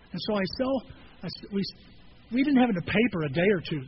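usual speaking voice

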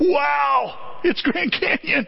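mock awe. Mock tourist awe at sign.

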